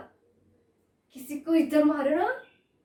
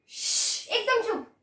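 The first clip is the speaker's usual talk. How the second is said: sharp shush, then high-pitched order; commanding hush; 8 semitones higher than usual. Acted. Elder sister silencing screaming sister, commanding hush. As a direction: urgently order total silence.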